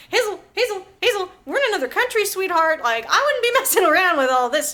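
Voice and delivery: high, urgent, playful coaxing; loud, high mock intervention; mock pleading. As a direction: talk an angry friend down, half laughing. Acts out calming Hazel down.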